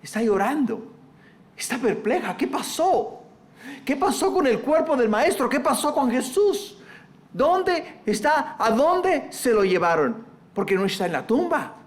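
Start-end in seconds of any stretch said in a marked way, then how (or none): 2.3-10.2 higher, bewildered questioning; a mild, lightly acted bewilderment; perplexed women's questions. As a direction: wonder aloud where he went.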